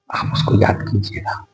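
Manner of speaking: hushed whisper of advice; soft whispered plea to the listeners. Real, not acted. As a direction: whisper it like a secret. Intimate advice to audience.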